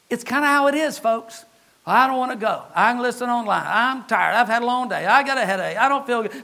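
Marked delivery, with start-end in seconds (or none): 1.9-6.4 softer, reluctant grumbling excuses; mock whiny excuses. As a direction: moan the excuses like a tired churchgoer. Mock churchgoer making excuses.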